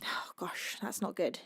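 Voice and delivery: soft, sighing, nearly whispered at times; collect yourself quietly after nearly crying; holding back tears. Real, not acted. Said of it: "I cannot cry".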